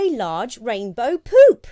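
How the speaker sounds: slow, loud comic reveal; loud punchline. Acted. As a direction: land the joke big. Punchline of the story.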